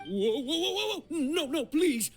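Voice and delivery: high-pitched frantic begging, stammering; panicked pleading. Acted. Cornered suspect begs the journalist not to hand over evidence, panicked. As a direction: stammer out a desperate, high surrender. Threatened with evidence handover, gives in.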